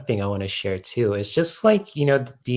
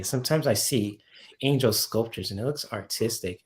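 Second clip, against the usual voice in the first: ordinary talk, nothing marked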